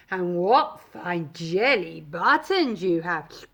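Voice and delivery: low, slow, lowing cow voice; mooing cow. A narrator's reading. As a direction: draw it out like a hungry cow. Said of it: "mooed the cow".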